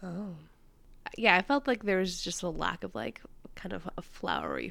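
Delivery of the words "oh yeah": ordinary talk, nothing marked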